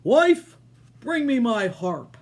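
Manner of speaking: giant shouting. Acted. Giant shouting for his harp, loud. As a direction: yell for the wife to fetch the harp. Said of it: "Then he shouted".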